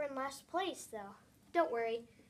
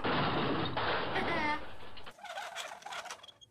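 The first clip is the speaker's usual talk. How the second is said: breathy laugh. Acted. After hitting rival.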